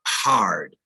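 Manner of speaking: Midwestern "hard" with a full R; Midwestern accent. Shown as a demonstration. Teacher showing Midwestern dialect, Midwestern accent. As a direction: sound the R in "hard", Midwestern style. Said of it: "You might hear the dialect".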